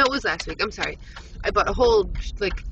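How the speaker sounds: usual speaking voice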